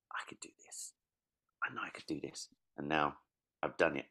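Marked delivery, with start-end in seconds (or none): 0.1-2.5 hushed, determined self-talk; whispered resolve. Retold or imitated. A child whispering to himself that he can do it. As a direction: whisper it to yourself like a hopeful kid.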